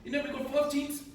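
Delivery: put-on high-pitched Kiwi accent; broad New Zealand bloke voice. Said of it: "your Kiwi accent's just getting higher and higher pitched".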